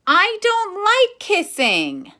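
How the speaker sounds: put-on high, loud childish whine; whiny child. Alexander complaining to the class about kissing, high whine. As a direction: whine it like a fed-up little boy.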